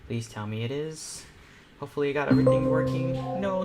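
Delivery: ordinary talk, nothing marked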